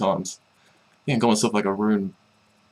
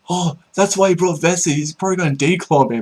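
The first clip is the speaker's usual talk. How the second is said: higher, suspicious realization; imitating Bloops; 9 semitones higher, 3 dB louder than usual. Bloops suspects him in the video, higher and knowing.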